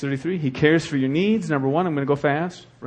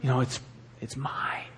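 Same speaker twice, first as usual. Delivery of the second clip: Gollum whisper; 2 semitones lower, 7 dB quieter than usual. Retold or imitated. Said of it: "No, I can't do it".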